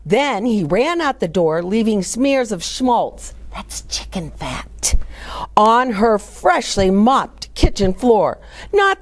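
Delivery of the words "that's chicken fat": slow whisper slipped in to the listeners; whispered aside. Real, not acted. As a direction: murmur the explanation slowly. Reader's aside while reading.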